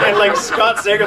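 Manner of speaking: ordinary talk, nothing marked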